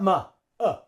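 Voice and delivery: "ma" short, with an "uh" vowel; schwa. Shown as a demonstration. Teacher shows the schwa, short and reduced. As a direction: cut "my" down to "muh". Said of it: "we schwa".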